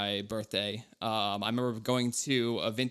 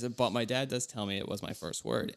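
usual speaking voice

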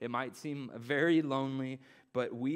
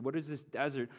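ordinary talk, nothing marked

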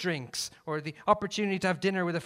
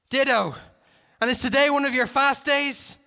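shouting; 11 semitones higher, 8 dB louder than usual. Acted. Shouted exchange.

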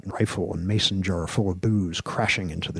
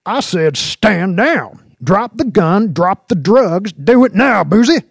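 high shouted orders, building louder; yelling, unnecessarily loud; 13 semitones higher, 10 dB louder than usual. A narrator's reading. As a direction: bellow commands at a suspect who won't obey. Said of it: "Jinx was yelling unnecessarily loud".

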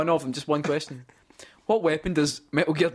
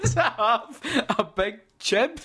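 laughing; 8 semitones higher, slower than usual. Real, not acted. Question while laughing.

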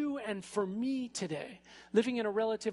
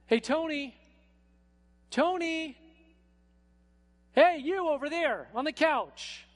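higher, louder call to get his attention; 9 semitones higher, 6 dB louder than usual. Retold or imitated. God calling Tony on the couch, higher and louder.

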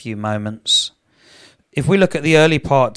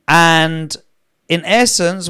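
ordinary talk, nothing marked

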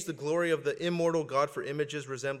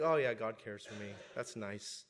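mock indifference; 5 semitones lower, 8 dB quieter than usual. Mocking complacent modern believers.

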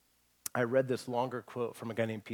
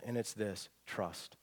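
dropping much softer and lower onto the last word; hushed reveal; 5 semitones lower, 9 dB quieter, slower than usual. Real, not acted. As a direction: let the word trust land softly, almost confidingly. Sermon lands on its key word.

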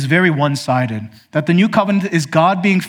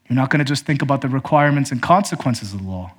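ordinary talk, nothing marked

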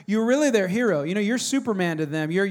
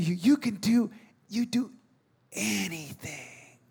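put-on slow, fading childlike awe, much softer at the end; little boy; 5 dB quieter, slower than usual. Dad voicing Riley's awe, fading much softer. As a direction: let it trail off in wonder. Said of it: "He was saying".

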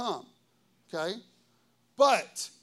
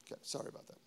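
whispered, much softer sorry to the room; quiet apology aside; 16 dB quieter, much faster than usual. Real, not acted. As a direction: drop to a whisper for a quick apology.

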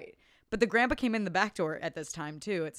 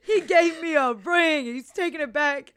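high, loud, slow mock wail; 8 semitones higher, 11 dB louder, slower than usual. Jilted fiancée complaining to the table, hammed-up wail. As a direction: ham up a sobbing complaint. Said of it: "she's like".

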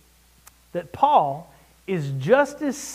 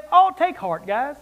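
breezy mock reassurance; 4 semitones lower than usual. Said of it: "Are you kidding?".

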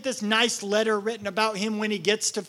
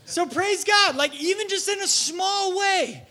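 high, loud, slower praise; joyful shout; 11 semitones higher, 5 dB louder, slower than usual. Real, not acted. Preacher shouts praise high and loud to his church. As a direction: lift the congregation with a cry.

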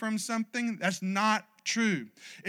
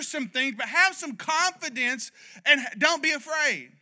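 raised, rousing preacher's cry, higher and louder; full-force preaching; 8 semitones higher, 5 dB louder than usual. Acted. Pastor rallies the church to put fear away, preaching at full force. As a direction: drive every line home to the congregation.

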